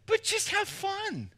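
soft, high, slow have fun; gentle urging. Acted. Urging congregation to relax.

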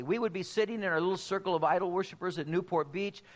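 ordinary talk, nothing marked

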